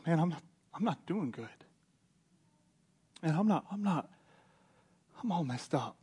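halting, subdued acted confession; mock tearful. Paul crying to Timothy on his arrival, acted tearful. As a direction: play a man breaking down, softly and in fits. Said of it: "he just starts crying".

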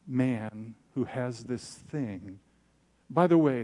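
normal talking voice

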